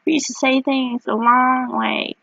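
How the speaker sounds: put-on old-timer telling kids. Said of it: "I told my kids".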